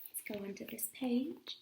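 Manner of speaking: soft whisper to the listeners; whispered aside. Real, not acted. Reader whispering to children while turning the page. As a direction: lean in and whisper.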